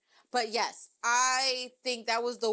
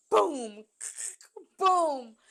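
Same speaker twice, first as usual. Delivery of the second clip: booming thuds, louder each time; imitated bed bang; 3 semitones higher, 4 dB louder than usual. Imitating the bed slamming the wall, booming. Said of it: "You heard the bed go".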